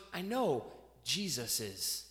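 low, slow and soft; as God, quietly sure, drawing out Jesus is; gentle low divine reply; God answering. Pretend.

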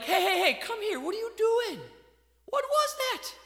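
high, sharp mock telling-off; a parent catching a kid hitting his brother; scolding parent.

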